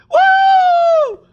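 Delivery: very high, loud woo; triumphant whoop. Real, not acted. Gamer whooping after the high five, loud cheer. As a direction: let out a victory whoop.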